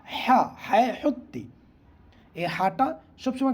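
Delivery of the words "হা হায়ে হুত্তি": হা pressed from the middle of the throat; throaty ha. Shown as a demonstration. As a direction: squeeze the হা out of mid-throat. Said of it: "গলার মধ্যখান দিয়ে চাপ দিয়ে উচ্চারণ হচ্ছে". (গলার মধ্যখান থেকে চাপ দিয়ে হা)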